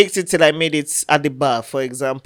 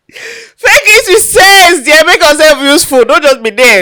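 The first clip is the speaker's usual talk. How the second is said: loud scolding; 9 semitones higher, 6 dB louder than usual. Real, not acted. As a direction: tell them off at full voice. Mock-scolding motto to listeners.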